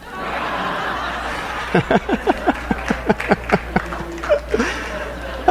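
long airy laugh at his own punchline; real, drawn-out breathy laughter. Real, not acted. Preacher laughing at his own Clinton joke, breathy laugh.

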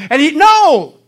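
sudden loud cry of no; shouted no. Retold or imitated. Preacher voicing a man, sudden shout. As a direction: cry it out at full force.

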